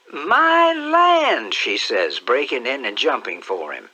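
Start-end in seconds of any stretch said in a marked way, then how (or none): 0.1-1.5 very high, loud joyful exclamation; aunt overjoyed at her nephew; delighted cry. Acted. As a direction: shriek of glad surprise.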